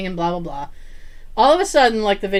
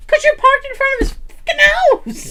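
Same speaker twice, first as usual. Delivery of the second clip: fast, much higher exasperated snap; exasperated retort; 4 dB louder, faster than usual. Retold or imitated. Narrator mocking scared kids in the parked car, exasperated. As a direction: throw it back at them, incredulous.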